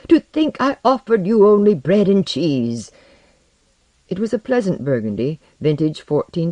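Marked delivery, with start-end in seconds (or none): 0.1-2.9 higher, louder self-reproaching exclamation; exclaim, appalled at your own poor hospitality; fervent cry. A narrator's reading. Alchemist apologising to his honoured guest, crying out. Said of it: "he cried".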